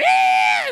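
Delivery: loud, high imitated shriek; sudden piercing mock scream. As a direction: shriek like someone disrupting church. Imitating someone screaming out in church.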